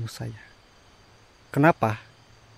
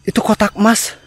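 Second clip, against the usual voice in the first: higher, loud, urgent; excited, agitated; 7 semitones higher, 12 dB louder than usual. Real, not acted. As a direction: call out the discovery. Excited at glowing box.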